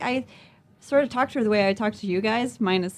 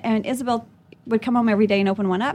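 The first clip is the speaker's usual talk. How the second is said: ordinary delivery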